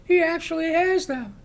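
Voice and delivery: high, loud panicked retold scream. Bank teller screams at the robber that he has the children, panicked. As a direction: cry it out in horror. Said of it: "she starts screaming".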